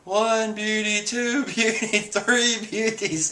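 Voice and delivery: high, slowly counted delirium; imitated dreamy, high-pitched rapture; mock swooning daze. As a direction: count like a lovestruck character in a stupor. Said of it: "in a coma of beauties".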